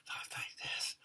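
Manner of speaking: creepy, voiceless whisper; demonic dog whisper. Acted. Reviewer imitating the movie's demonic dog, creepy whisper. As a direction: whisper it like an inhuman hellhound. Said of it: "he's whispering, but no, no, it's worse".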